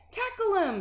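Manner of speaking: high, loud screaming order; lunch lady scream. A narrator's reading. Lunch ladies screaming during the chase. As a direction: scream for a tackle. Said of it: "screamed the lunch ladies".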